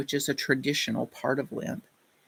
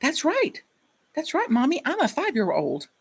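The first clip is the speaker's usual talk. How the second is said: put-on much higher, eager child voice; excited little boy; 11 semitones higher than usual. Boy answering his mommy, excitedly high child voice. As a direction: bounce up like a thrilled five-year-old. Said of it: "he got real excited".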